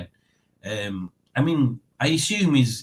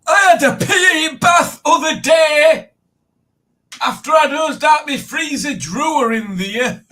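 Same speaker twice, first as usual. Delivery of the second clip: high, loud shrieking funny voice; 8 dB louder than usual. Pretend. Reading Tracy's joke in a silly voice, shouted with a laugh. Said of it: "I'll do it in a funny voice".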